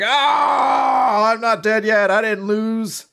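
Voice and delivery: very high, loud hammed-up battle cry; mock heroic shout. Captain bursting out of the rubble, loud high heroic shout. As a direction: roar it like an anime hero.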